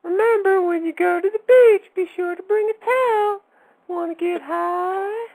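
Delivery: squeaky cartoon towel asking if you wanna get high; high-pitched mock Towelie; Towelie impression. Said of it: "He wants to get high".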